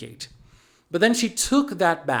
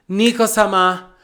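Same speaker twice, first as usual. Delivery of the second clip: name called out high and loud; big, raised-voice fangirl reveal; excited dramatic announcement; 4 semitones higher, 7 dB louder than usual. Acted. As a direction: proclaim the name with hype. Emphatic repeat of the reveal.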